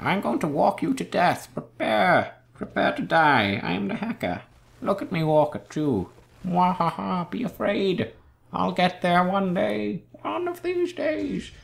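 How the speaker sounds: high, slow evil villain voice with cackle; mock menacing villain gloating; mad scientist.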